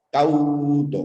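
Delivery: "cauto" with a long "cau", then "to"; syllable boundary shown. Shown as a demonstration. Teacher repeating the second example, split syllables. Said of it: "empieza en consonante".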